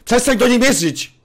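loud, high shouted command; gniewny rozkaz. Acted. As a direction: shout to protect her. Broni kobiety przed bronią. (ostry krzyk w obronie)

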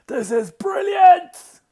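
high, loud, slow shout of joy; delighted child. Acted. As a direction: shout it out, thrilled and high-pitched. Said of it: "Hal was delighted".

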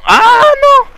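very high, slow, loud squeal of surprise; startled squeal. Real, not acted. Gamer reading a sign cries out as he falls. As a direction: shriek as the boat tips.

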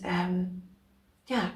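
usual speaking voice

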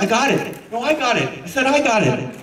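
calling for the ball. Acted. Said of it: "call out".

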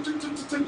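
light, high clicky tic sounds mimicking ice in a glass; imitated ice clinking. Host mimicking the radio show's champagne ice sound effect, light clicks. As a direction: tap out the ice effect softly with your voice. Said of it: "Te ponían los efectos de champán".

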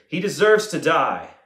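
condemning verdict. A narrator's reading. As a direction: pronounce the verdict loudly and emphatically. Council answers with condemnation.

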